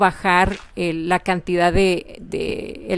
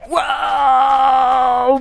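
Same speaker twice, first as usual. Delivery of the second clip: asombro; 4 semitones higher, 4 dB louder than usual. Real, not acted. Ve construir su edificio.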